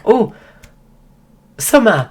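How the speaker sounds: high, loud acted "oh!" of remembering; delighted recognition. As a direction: imitate someone suddenly placing a name. Drunk acquaintance recalls sister's name.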